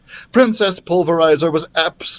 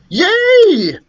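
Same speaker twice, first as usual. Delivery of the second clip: loud, high cheer; delighted dragon's cheer; gleeful shout; 7 dB louder than usual. A narrator's reading. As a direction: whoop it out. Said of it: "Dribble shouted".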